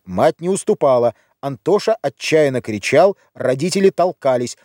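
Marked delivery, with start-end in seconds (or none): none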